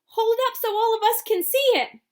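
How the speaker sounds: high, loud call; shouting. A narrator's reading. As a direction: shout it across the crowd. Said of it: "someone shouted".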